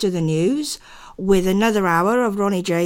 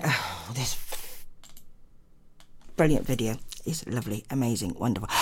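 dry sarcasm, much lower and softer; sarcastic praise for a broken video; 9 semitones lower, 10 dB quieter than usual. Real, not acted. Complaining about video being blocked.

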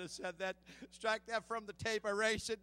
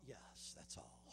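low, hushed whisper; whispered reply; 15 dB quieter, slower than usual. Retold or imitated. Preacher voicing God's answer to him, whispered. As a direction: breathe the answer softly.

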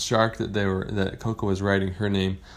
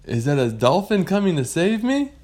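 a higher, hopeful question; hopeful wonder; 8 semitones higher, 4 dB louder than usual. Acted. Lost pirate spotting a fin, high hopeful question. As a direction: ask with a touch of excited hope. Frightened swimmer spots a fin.

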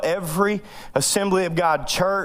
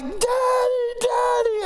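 yelling for dad; much slower than usual. Retold or imitated. Kid in a pushing crowd calls his dad, high desperate yell. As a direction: call out like a scared kid in a crowd. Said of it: "they're like".

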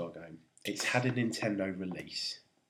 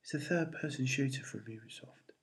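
whispered to himself; whispering; 3 semitones higher, 6 dB quieter than usual. Real, not acted. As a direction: breathe it under your breath. Muttering the clues to himself.

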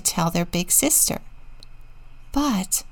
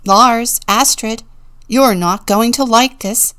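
loud, higher alarmed pig; raised voice, warning of bad news; calling out; 4 semitones higher, 9 dB louder than usual. A narrator's reading. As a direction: call the neighbours urgently.

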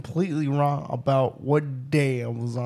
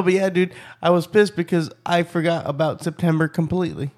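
ordinary delivery

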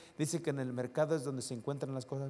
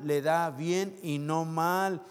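a bit quicker echo, ending on "mal"; slow repeat; 4 semitones higher, 6 dB louder, slower than usual. Shown as a demonstration. Pastor echoing the verse to the church, slow repeat. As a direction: repeat it firmly once more. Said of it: "verse said twice".

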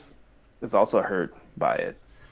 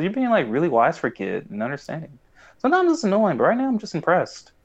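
high mock Sokka; 7 semitones higher than usual. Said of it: "he says".

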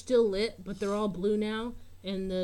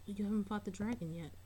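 whispering; 8 dB quieter, faster than usual. Real, not acted. Whispered reminder to players.

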